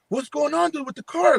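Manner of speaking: mock confusion at the failing car; alarmed friend. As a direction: friend puzzled by dashboard lights, high mock alarm.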